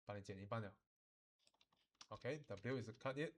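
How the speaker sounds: ordinary delivery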